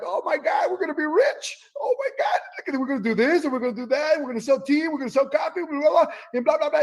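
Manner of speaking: high, gushing dreams of getting rich; giddy excitement. Retold or imitated. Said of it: "and you go like".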